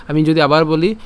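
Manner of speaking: ordinary talk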